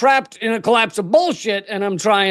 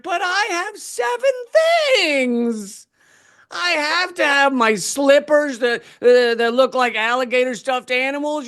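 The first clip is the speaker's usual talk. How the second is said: whiny protest; 4 semitones higher than usual. Acted. Passenger objecting to the five things limit, whiny protest. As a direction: plead your case like a put-out customer.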